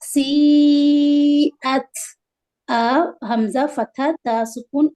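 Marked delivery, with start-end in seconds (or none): 0.0-1.9 سیئت with the ee held three to four beats; loud chanted سیئت with a long sustained ee; long madd. Shown as a demonstration. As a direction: stretch the first vowel of سیئت loudly, then end on at. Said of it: "تین سے چار حرکت لمبا کریں گے".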